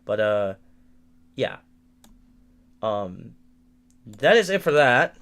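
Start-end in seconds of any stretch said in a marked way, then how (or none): none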